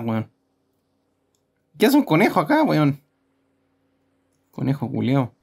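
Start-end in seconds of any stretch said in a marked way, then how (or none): 1.8-3.0 high startled question; surprised. Real, not acted. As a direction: jump up in surprise. Sudden rabbit appears.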